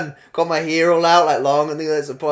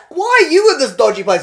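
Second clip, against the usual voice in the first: much higher, louder surprised question; 10 semitones higher, 6 dB louder than usual. Retold or imitated. Narrator shocked to see his boss, surprised. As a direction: blurt it out, caught off guard.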